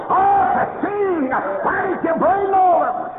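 high, slow, loud hammed-up yell; mock shout. Preacher mocks a churchgoer with a loud high yell. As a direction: shout it across the church in jest. Acted retort in church quarrel.

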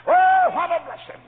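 high, loud ecstatic outburst; preacher's rapturous exclamation; joyful cry. Real, not acted. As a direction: burst out with joy.

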